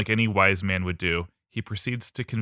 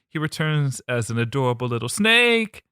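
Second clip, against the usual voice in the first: usual speaking voice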